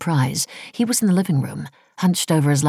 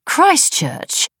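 high, louder incredulous echo; 14 semitones higher, 4 dB louder than usual. A narrator's reading. Wife echoing husband's unexpected destination, incredulous. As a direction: repeat the place as if absurdly remote. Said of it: "in the same tone as she might have said Antarctica".

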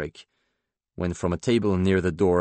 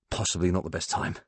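tobacco-rich English voice, mildly apologetic; understated apology in a rich English accent; 5 dB quieter than usual. Acted. Robert interrupting Paul's scene, apologetic tobacco-rich English accent. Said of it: "apologetically in a tobacco-rich English accent".

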